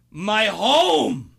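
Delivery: loud, high, slow Pacino yell; Al Pacino shout. Retold or imitated. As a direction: bellow it like an enraged Pacino. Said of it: "my favorite line".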